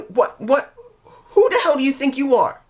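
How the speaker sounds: a furious wrestler demanding answers; outraged yelling. Retold or imitated. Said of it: "And she's so mad".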